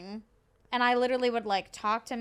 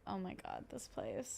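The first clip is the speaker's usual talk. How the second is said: soft, half-whispered groan at work; weary mutter; 3 semitones lower, 14 dB quieter than usual. Retold or imitated. Coworker groaning to coworker at five am, hushed. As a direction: breathe it out, drained and fed up.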